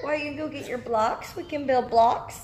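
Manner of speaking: ordinary delivery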